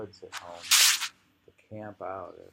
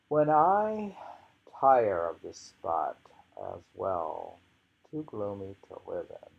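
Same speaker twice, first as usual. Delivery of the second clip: louder poetry recital with long pauses between phrases; poem reading; 8 dB louder than usual. Acted. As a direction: declaim the first line, then let it settle.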